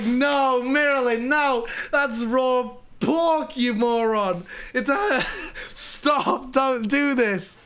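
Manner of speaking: high, drawn-out laughing scolding; mock exasperation. Friends scolding guest over raw pork, high exasperated plea.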